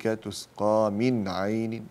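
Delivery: slow تسقى من عين with a distinct n before ع; drawn-out recitation, unblended من عين; clear noon. Shown as a demonstration. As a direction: sound the n of من cleanly, then عين. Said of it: "إظهار بعد العين".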